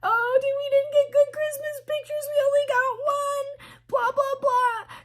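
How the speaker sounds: very high mock wail; hammed-up whining kid in a squeaky singsong; upset child. Daughter upset over Christmas pictures, mocking high whine. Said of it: "She's upset because she's like".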